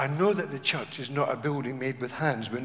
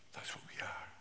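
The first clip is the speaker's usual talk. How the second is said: whispered aside; 15 dB quieter than usual. Real, not acted. Quiet affirmation after verse.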